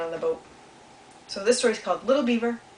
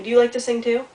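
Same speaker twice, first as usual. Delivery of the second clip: usual speaking voice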